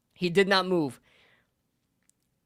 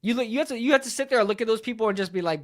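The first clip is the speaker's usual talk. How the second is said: ordinary talk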